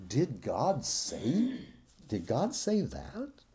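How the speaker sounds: soft, drawn-out insinuating question; quietly sow doubt with slow, rising words; hushed, slow, lilting tempter; subtle serpent. Acted. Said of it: "the subtle serpent, who said".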